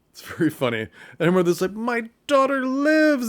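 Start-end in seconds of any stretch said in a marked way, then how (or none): 1.7-3.3 much higher, slow, loud theatrical mother; hammed-up joyful mother; melodramatic mother. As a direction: wail it like a stage mother. Mother voiced in retold scene.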